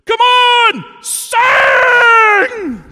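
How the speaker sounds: very high, very loud shout; imitating a fan shouting very high and loud. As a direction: yell it at your mate to join in. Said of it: "he turns around to me and he goes".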